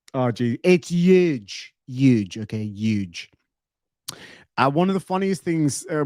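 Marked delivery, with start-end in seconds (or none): 0.6-3.3 huge, drawn out Trump style. Acted. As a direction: streamer playing his Trump catchphrase, light Trump. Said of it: "my Trump voice".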